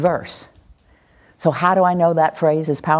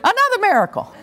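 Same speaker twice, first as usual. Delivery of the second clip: mock amazement; faster than usual. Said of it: "you're like".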